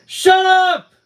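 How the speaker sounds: furious high scream, very loud. Acted. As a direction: scream them into silence. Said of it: "she screamed at us".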